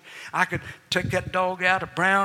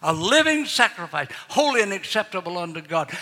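normal talking voice

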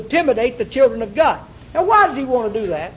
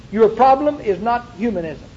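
ordinary talk

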